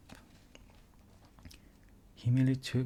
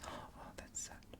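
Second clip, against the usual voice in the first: whispered aside; 18 dB quieter, slower than usual. Real, not acted.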